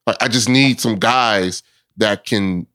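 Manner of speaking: normal talking voice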